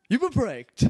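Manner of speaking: high, loud gotcha announcement; triumphant mock announcement; gleeful prank reveal. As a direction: crow it out, springing the prank.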